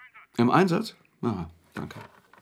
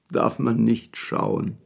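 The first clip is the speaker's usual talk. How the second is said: slow, heavy recital of a gloomy poem; reciting verses; slower than usual. Acted. Wetschorowski recites ominous verses to the anxious Maljanow, slow and solemn. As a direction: intone the lines slowly, like a dark prophecy. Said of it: "Dass es sich um Verse handelte".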